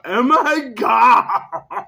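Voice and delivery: long, high, very loud wail; wailing mock shout. Reading what all people call out, hammed-up wailing shout. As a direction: howl it out for laughs. Joke retake of the cry.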